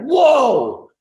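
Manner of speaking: high, loud imitated whoa; mock kid amazement. Imitating a kid seeing the car, high and loud amazement. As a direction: play a kid wowed by a car.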